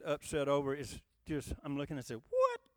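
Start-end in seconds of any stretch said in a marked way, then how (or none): 2.2-2.6 high-pitched mock disbelief; a much higher, baffled "what?"; retold reaction of sheer disbelief; incredulous. Mimics own reaction to nonsense.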